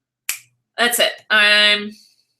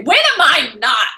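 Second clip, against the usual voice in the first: slow, louder mock-indignant retort; mock ragey; 4 semitones higher, 5 dB louder, slower than usual. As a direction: throw it back like it's obvious. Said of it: "You're really ragey and deep today".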